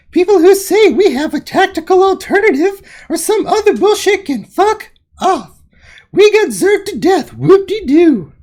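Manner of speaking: high, loud put-on Celeste voice. Reading rival chat aloud in a high loud mock Celeste voice. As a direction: squeak out the gloating rival leader's lines. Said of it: "an accurate Celeste voice".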